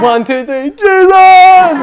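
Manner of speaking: counting up then a very high, loud shout. Retold or imitated. Two friends shouting over the lake, loud. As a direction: count it off and yell the name. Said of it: "We were just shouting".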